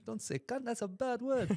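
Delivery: very high put-on scolding; mock African guard. Guard objecting to grandma's swearing, mock African guard. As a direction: chide her like a comically prim guard. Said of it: "the guards were like".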